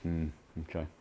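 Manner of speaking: low, quiet mutter to oneself; barely voiced, private musing; real mutter. Real, not acted. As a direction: mumble it softly under your breath. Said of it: "I kind of muttered".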